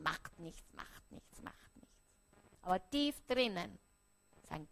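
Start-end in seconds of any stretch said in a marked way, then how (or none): 0.0-1.9 repeated, fading to a whisper. Acted. Preacher voicing a hurting child, fading whisper. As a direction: let the denial die away into a whisper.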